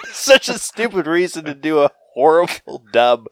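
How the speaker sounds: high, loud laugh-through; laughing while talking. Real, not acted. Laughing at the dub story.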